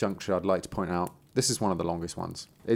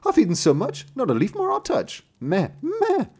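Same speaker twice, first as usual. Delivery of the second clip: very high, loud goat voice; bleating goat; 14 semitones higher, 4 dB louder than usual. Acted. Goat answers the youth at night, high bleating voice. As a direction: bleat the rhyme shrilly like a goat.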